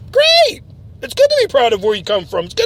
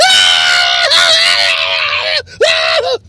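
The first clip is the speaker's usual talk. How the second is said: mock evil cackle; 4 dB louder than usual. Acting out a bombing scene.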